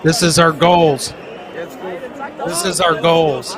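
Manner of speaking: loud, punchy announcer call; hype announcement. Acted. Host hyping the year's goals to his viewers, loud and punchy. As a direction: pump up the crowd about the goals.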